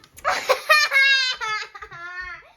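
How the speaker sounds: loud rising giggle; giggling fit. Real, not acted. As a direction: burst into giggles. Laughing fit.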